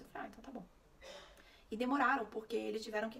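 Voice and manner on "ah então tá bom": much softer, deflated okay; mother giving up on surgery, softly resigned. Retold or imitated. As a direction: give in with a defeated sigh. Resigned acceptance.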